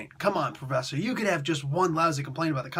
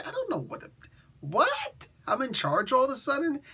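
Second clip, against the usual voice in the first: bewildered Sonja; 11 semitones higher than usual. Retold or imitated. Red Sonja made queen suddenly.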